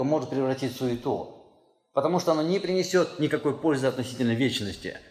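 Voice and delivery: normal talking voice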